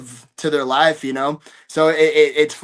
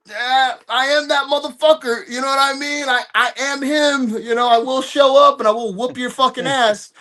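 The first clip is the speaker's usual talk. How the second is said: laughing, higher-pitched tough-guy boast; cocky threat with a laugh; 7 semitones higher than usual. Real, not acted. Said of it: "I do have that side of me".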